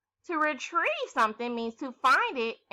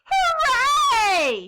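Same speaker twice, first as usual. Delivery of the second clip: very high, loud cheer; cry of joy; 12 semitones higher, 9 dB louder than usual. Acted. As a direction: burst out in triumph. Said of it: "she cried".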